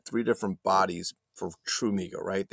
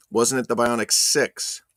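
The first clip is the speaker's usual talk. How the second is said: ordinary talk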